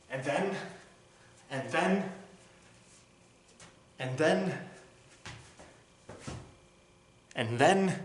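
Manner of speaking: quiet, halting build-up; hushed suspense. Real, not acted. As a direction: hold back each and then. Drawn-out suspense.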